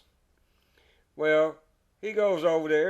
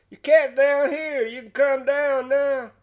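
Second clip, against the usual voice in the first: higher, louder taunting call up the tree; mocking call; 11 semitones higher, 7 dB louder than usual. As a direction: tease him from below. Joe laughing at stuck neighbor.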